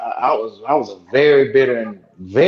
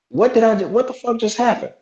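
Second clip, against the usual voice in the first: high, stunned retold disbelief; 10 semitones higher than usual. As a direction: replay the moment the shock hit. Retelling shock at the firing.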